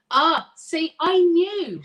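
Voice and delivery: loud, high burst of recognition; excited vindication. Real, not acted. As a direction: exclaim it as your hunch comes true. Her hunch confirmed by viewer message.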